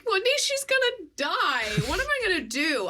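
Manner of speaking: high-pitched retold fretting; mock panic. Retelling fear that new cat will die, high mock panic. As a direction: imitate yourself panicking, voice shooting up.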